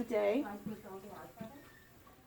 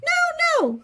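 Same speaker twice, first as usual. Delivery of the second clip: high-pitched, louder cry; Little Mouse; 5 dB louder than usual. A narrator's reading. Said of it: "Little Mouse cried".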